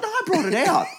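high mock protest, laughter creeping in; insistent waitress. Acts waitress insisting, in argument.

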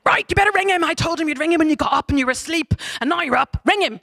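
high, loud, bossy mum; stern mum. Retold or imitated. Mum ordering the girl to phone her dad, high and bossy. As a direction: order her to ring him now. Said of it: "her mum is like".

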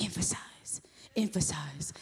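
mock whispered repeat of the word; teasing whisper. Husband Gilbert teasing her about her word, mock whisper. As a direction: whisper emphasize, emphasize to tease her. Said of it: "he's gonna be like".

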